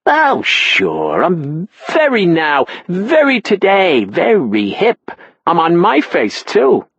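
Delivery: a hint of a chatty, proud mom; mother's voice. A narrator's reading.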